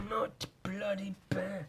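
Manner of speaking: high, soft, slow put-on voice; playful self-approval. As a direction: draw it out softly in a high silly voice.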